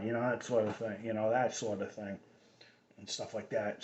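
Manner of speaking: Cambridge Kennedy-style Boston accent, Harvard said without r; a light Harvard Cambridge accent. Shown as a demonstration. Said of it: "which is the Kennedy accent".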